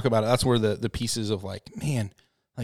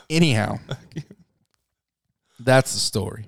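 ordinary delivery

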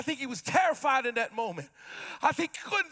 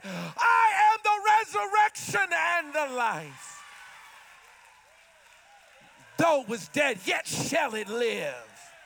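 Jesus proclaiming, preached full force; 4 semitones higher, slower than usual. Acted. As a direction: thunder it from the pulpit. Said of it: "Jesus said".

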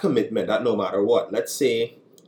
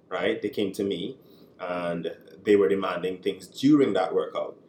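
ordinary talk

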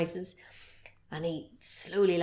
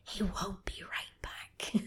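hushed, ominous whisper; spooky whisper; 11 dB quieter, slower than usual. Acted. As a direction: whisper it like a ghost story. Spooky aside after the quote.

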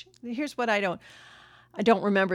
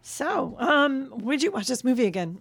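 hoarse voice; 6 semitones higher than usual. Real, not acted. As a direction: voice going hoarse and strained. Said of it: "I'm losing my voice here".

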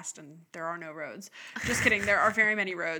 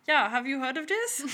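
lightly put-on Norwegian accent, higher; a hint of a Norwegian accent, brighter; 5 semitones higher, 5 dB louder than usual. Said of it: "That's not a good Norwegian accent".

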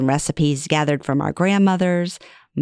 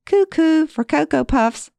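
usual speaking voice

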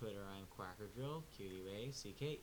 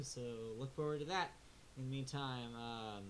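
normal talking voice

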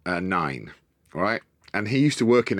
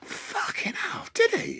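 breathy, slow, much higher gasp of disbelief; stunned whisper; 4 dB quieter, slower than usual. Retold or imitated. Son learning his uncle went to the school, stunned whisper. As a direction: whisper it, floored by the news.

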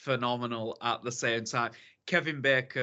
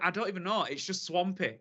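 usual speaking voice

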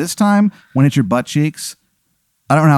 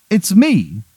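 high-pitched, hammed-up English accent; Henry the eighth, bad English accent; 9 semitones higher than usual. King greets Aaron, in a bad English accent. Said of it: "it's a very bad English accent".

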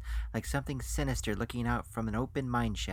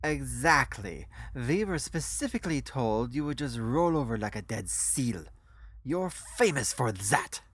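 put-on accented foreign financier, emphatic; 3 dB louder than usual. Financier tells the guest he is famous for yielding, emphatic accent. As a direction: agree firmly, leaning on the words. Voices Rolf agreeing.

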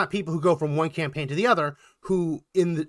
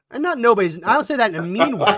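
laughing; 6 semitones higher, 4 dB louder, faster than usual. Real, not acted. Laughs while softening remark.

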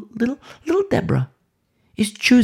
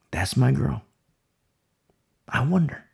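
ordinary delivery

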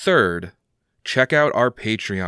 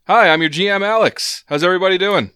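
put-on old-timey announcer voice; 8 semitones higher, 6 dB louder, faster than usual. Announcer voice carries into greeting.

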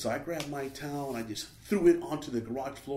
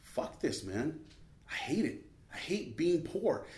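softly seethe to yourself; retold frustration; 3 semitones higher, 6 dB quieter than usual. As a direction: frustration held in, replayed quietly. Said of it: "all that frustration, I'm just like".

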